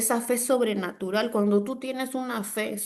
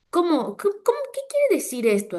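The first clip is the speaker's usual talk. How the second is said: high, hurried questioning voice; stumbling, high-pitched mock bewilderment; puzzled listener; 9 semitones higher than usual. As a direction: mimic a baffled listener, pitched way up.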